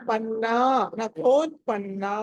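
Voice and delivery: పన్నా with the last vowel stretched; long final "naa". Shown as a demonstration. Teacher insisting on the dirgham, పన్నా with long "naa". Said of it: "పెట్టుకోండి అని చెప్పాలి".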